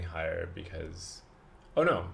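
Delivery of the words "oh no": sudden dismayed oh no. Real, not acted. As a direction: react in alarm, louder and higher. Sees high costs.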